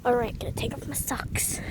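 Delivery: hushed aside to camera; whispering. Real, not acted. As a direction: whisper it to yourself.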